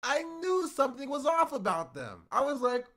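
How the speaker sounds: normal talking voice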